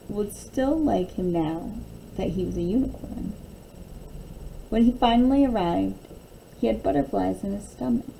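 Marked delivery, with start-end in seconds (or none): none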